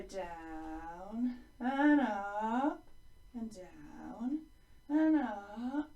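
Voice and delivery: slow glides sinking on "down", rising on "up"; stretched swooping words for a child to follow; voice tracing the line. Shown as a demonstration. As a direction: let the voice slide down and up with the line. Voice follows the line's direction.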